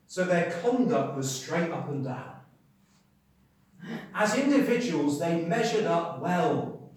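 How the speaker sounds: loud, high, slow proclamation; ringing pulpit delivery, slow and weighty; emphatic preaching. Acted. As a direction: preach it out, drawing out the key words. Preaches at full force.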